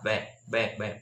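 "bed" slow with a short open e; bed demo. Shown as a demonstration. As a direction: slowly repeat "bed". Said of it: "E, bed".

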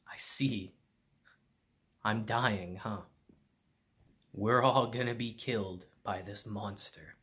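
low, softened voice of resignation; dying fighter. Acted. Dying fighter realizing his comrades are doomed, low and subdued. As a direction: quietly take in that it's over.

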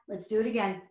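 ordinary talk, nothing marked

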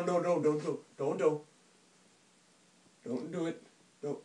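ordinary delivery

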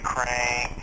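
ordinary talk

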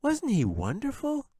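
put-on young girl voice; Katie's voice. A narrator's reading. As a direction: play the little girl, scoffing. Katie gushing.

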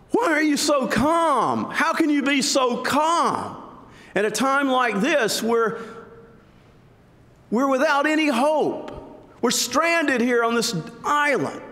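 high, frantic mock panic; panicked castaway. Panicked shipwrecked man.

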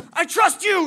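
very high, full-force shout; all-in battle yell. Retold or imitated. Teammate to guide before jumping on the anaconda, reckless shout. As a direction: yell it as you leap into danger.

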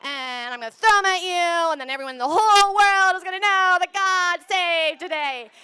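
building from calm to a loud, high rallying cry; a kid charging into battle, sure God wins; defiant excitement. Pretend. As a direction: start steady then roar with confidence. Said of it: "But instead being like".

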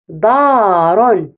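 put-on دار stretched out very slowly for children; slow modelled word. Shown as a demonstration. Teacher models the word slowly for children to repeat. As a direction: draw out دار slowly, syllable by syllable. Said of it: "رددوا".

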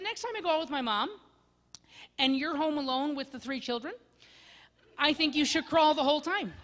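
teasing wife. Retold or imitated. Wife texting a teasing comeback to her husband. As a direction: tease him back, laughing. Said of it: "She said".

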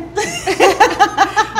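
bright high burst of laughter; high, loud laugh at the funny name; real laugh. Real, not acted. Host laughs at guest's name.

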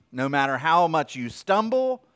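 ordinary talk, nothing marked

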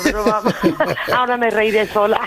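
laughing it off. Real, not acted. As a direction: laughs through the words. Prank revealed, she laughs.

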